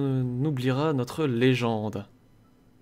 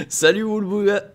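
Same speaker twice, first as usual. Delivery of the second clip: voice cracking; 5 semitones higher, 5 dB louder than usual. Real, not acted. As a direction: voice cracking on "Salut, Woolbooga". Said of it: "j'ai la voix qui part en sucette".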